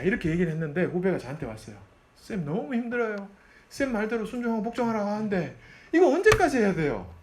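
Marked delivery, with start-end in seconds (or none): 2.2-7.1 complaining junior. Retold or imitated. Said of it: "이렇게 얘기하더라고".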